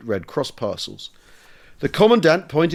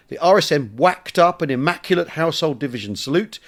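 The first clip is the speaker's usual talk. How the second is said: ordinary talk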